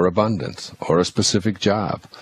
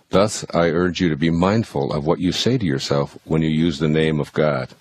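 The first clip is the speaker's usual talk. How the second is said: ordinary delivery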